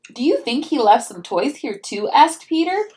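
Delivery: lively storybook read-aloud; reading to children. A narrator's reading. Mom reading a picture book to her child, storybook voice.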